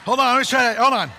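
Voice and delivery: loud call over a dead mic. Real, not acted. Pastor calling out as the mic cuts out, loudly. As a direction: shout to get the sound back.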